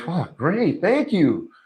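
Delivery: high-pitched, gushing gratitude; delighted thanks. Acted. Boss to helper who finished most tasks, delighted. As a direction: act out grateful delight, voice swooping high.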